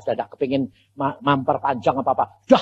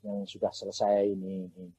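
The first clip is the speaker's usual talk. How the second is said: voice of God; 9 semitones lower, 9 dB quieter than usual. Retold or imitated. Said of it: "rumah siapa Tuhan".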